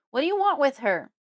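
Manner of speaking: loud furious demand; father yelling. A narrator's reading. Father shouting at Fred to protect daughter. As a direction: bellow it at the floor. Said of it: "he yells again".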